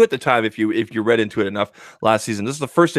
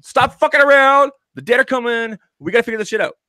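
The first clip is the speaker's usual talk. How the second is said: mock urgent Bran; 12 semitones higher than usual. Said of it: "And he's like".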